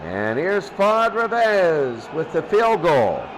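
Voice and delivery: ordinary talk, nothing marked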